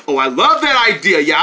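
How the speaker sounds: ordinary talk, nothing marked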